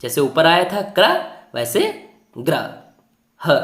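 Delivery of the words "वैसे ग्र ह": ordinary delivery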